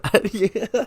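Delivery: a high real giggle at himself. Real, not acted. Laughing at his own awkward wording, a high giggle.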